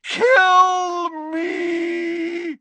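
long, high agonized howl; yelling. A narrator's reading. Professor drains the goblet and yells in agony. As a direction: howl it out in torment. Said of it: "then he yelled".

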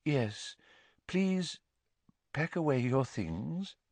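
soft, high, dreamy dismissal; hushed, faraway mystic voice; misty voice. A narrator's reading. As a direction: let each phrase drift off with pauses. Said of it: "in her mistiest voice".

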